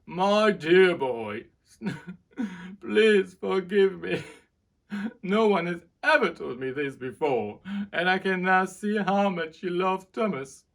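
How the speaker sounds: the king begs forgiveness through tears; tearful king. A narrator's reading. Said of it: "he started to cry".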